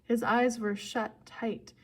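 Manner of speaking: usual speaking voice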